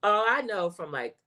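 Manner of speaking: usual speaking voice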